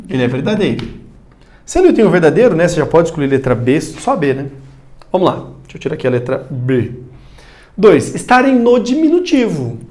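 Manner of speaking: normal talking voice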